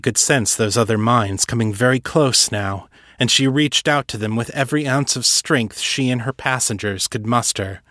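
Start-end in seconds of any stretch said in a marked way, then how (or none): none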